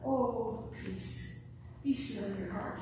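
hushed, breathy "peace, be still"; gentle whispered "Oh, peace, be still in your heart"; soft and gentle. Shown as a demonstration. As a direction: say it like a tender lullaby. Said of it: "It wasn't".

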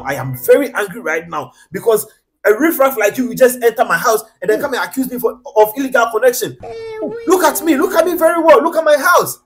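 building to a loud, high-pitched rant; indignant tirade at an accuser; very angry. Acted. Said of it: "I'm so angry right now".

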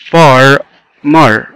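"far، مر" as two separate beats; split into syllables. Shown as a demonstration. Teacher counting two syllables, "far، مر" pulled apart. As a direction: pause between "far" and "مر". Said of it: "متكونة من two syllables".

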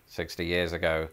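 usual speaking voice

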